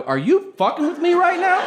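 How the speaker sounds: high, loud mock outrage; disbelief. Comedian to the fan on a flight, shrieking in disbelief. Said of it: "And I go".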